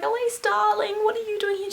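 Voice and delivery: high-pitched imitation of a doting teacher; sweet, high mock-teacher voice; kindly teacher. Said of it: "she came over and she was like".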